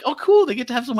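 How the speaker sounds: high, gushing excitement; mock delight. Reacting to Bamboo joining, high excited gush. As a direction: squeal like a fan at a cute moment. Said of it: "And I was like".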